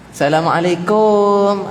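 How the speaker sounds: "Assalamualaikum" dragged very long, high and loud at the end; over-stretched salam. Shown as a demonstration. Preacher mocking women who over-lengthen the salam, high and loud. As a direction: stretch the last syllable of "Assalamualaikum" far too long. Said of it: "Ma'arik li sukun".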